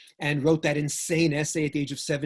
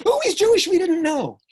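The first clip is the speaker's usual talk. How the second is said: surprised students; 8 dB louder than usual. Retold or imitated.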